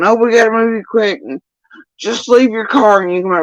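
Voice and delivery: high, fast mock urging; rushed urging. The guys rushing him out, fast high mock urgency. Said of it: "Chase and his guys".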